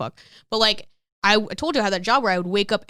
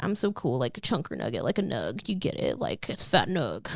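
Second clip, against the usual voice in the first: dopey college stoner bragging about her nickname; mock stoner voice; 6 semitones lower, 7 dB quieter than usual. Retelling her college stoner self.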